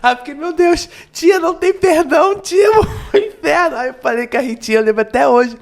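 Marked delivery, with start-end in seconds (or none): 0.3-3.8 high, loud, laughing-crying fright; mock child panic. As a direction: replay a scared child's outburst with a laugh. Child panicking about unforgivable sin.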